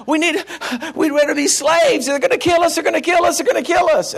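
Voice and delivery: high, frantic they're gonna kill us; mock panicked crowd. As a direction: play the terrified Israelites. Israelites voiced in fear.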